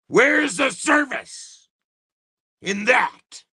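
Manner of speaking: high and slow indignant demand; indignant outburst. Real, not acted. Complaining about the tax office service, indignant and slow. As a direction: drag it out in outrage.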